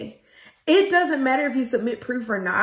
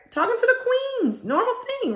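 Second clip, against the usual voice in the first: breezy high aside; 12 semitones higher, faster than usual. Real, not acted. High sing-song aside about routine.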